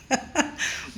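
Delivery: short breathy chuckle; real laugh. Real, not acted. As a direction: chuckle briefly at the memory. Laughs about being worked hard.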